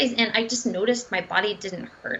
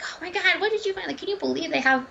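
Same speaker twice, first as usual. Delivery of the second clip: high-pitched, incredulous retold excitement; amazed disbelief; 9 semitones higher than usual. Dietitian couple reacting to what their research turned up, amazed disbelief. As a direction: act out astonishment, pitch jumping up.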